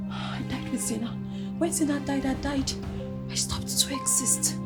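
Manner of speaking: very soft, drained confession; soft, barely voiced mourning; hushed grief. Grieving mother tells of loss.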